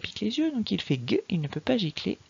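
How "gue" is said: "G" voiced hard at the back of the mouth, never "j"; hard "gue" for "G"; hard G sound. Shown as a demonstration. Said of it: "on a bien le son G".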